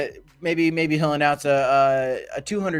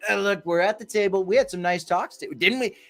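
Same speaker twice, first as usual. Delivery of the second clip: higher-pitched, lightly voiced chummy host; coaxing agreement in a raised voice; mock press-event host; 6 semitones higher than usual. Politician showing off roundtable talks to the press, higher-pitched mock chumminess. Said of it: "brought the press in to show everybody".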